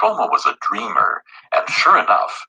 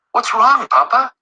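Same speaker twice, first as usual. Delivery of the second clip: put-on high, bright little girl asking; a small daughter rushing in, worried; child's voice; 5 dB louder than usual. A narrator's reading. Read to children: little girl asks her father.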